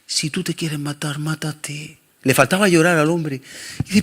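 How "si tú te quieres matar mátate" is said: retold quiet words. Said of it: "me lo dijo así".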